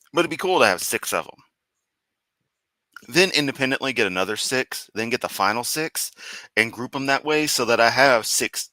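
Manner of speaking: ordinary talk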